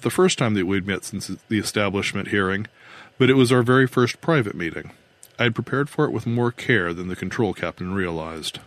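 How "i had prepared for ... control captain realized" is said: ordinary delivery, nothing marked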